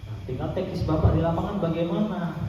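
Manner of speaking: ordinary talk, nothing marked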